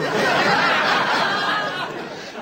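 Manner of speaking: high giggling laugh; burst of laughter. Real, not acted. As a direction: laugh out loud. Laughing at absurd twist.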